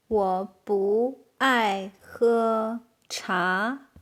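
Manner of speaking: very slow, separated syllables; word by word. Shown as a demonstration. Teacher translating "I don't like to drink tea", very slowly. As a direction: stretch every word out for learners. Very slow model, later repeated.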